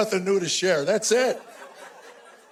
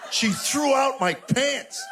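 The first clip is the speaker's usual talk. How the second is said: high, slow outraged cry; outraged disbelief; 6 semitones higher, much slower than usual. Real, not acted. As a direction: stretch it out in shocked outrage. Discovering pants in trash.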